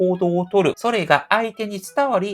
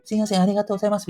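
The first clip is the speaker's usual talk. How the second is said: rushed thanks; 3 semitones higher, much faster than usual. Retold or imitated. Quick thanks leaving the elevator.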